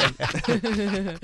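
big burst of laughter; a loud real laugh. Real, not acted. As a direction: crack up at her own joke. Laughing at own bear joke.

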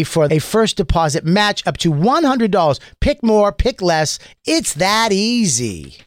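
upbeat sponsor read. Acted. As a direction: read it like a radio ad.

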